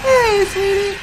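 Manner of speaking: very high, squeaky greeting; high cartoon voice. Greeting the host, squeaky and very high. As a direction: chirp it like a little puppet.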